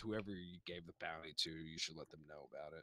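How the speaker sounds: low, soft ogre tavern keeper; low and hushed, like a gentle giant introducing himself; Grunt, the nighttime tavern keep, low and soft; low ogre barkeep. Acted.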